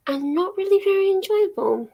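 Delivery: ordinary talk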